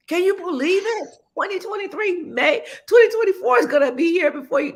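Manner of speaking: loud, high and fast excitement; excited. Real, not acted. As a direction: exclaim it in disbelief. Host marvels at time flying.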